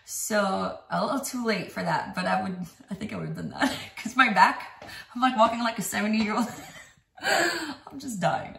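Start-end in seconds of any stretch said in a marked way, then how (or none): 5.1-8.6 laughing tiredly. Real, not acted. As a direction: laugh through the fatigue. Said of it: "I'm exhausted".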